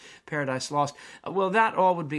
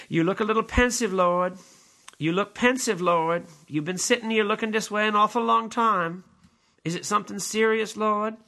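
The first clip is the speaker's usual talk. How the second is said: higher, louder anxious angel voice; Gabriel asking; 7 semitones higher, 5 dB louder than usual. Acted. Gabriel asks the pensive Lord, higher and louder. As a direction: play Gabriel, worried and gentle. Lecturer acts Gabriel from the play.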